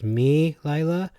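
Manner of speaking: "me Lila" as MEE-lie-la, questioning rise; stress on first syllable. Shown as a demonstration. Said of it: "put a different emphasis on words".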